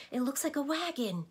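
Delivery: put-on a boy spotting something thrilling; excited cry. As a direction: call out with a child's excitement. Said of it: "cried Milo excitedly".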